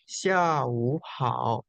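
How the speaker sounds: "xia wu hao" drawn out slowly, tone by tone; slow careful tone model; slow tone demo. Shown as a demonstration. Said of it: "Nada tiga ketemu nada tiga, jadi nada dua".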